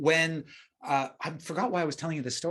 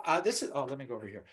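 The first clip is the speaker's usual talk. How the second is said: normal talking voice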